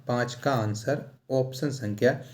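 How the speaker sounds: ordinary talk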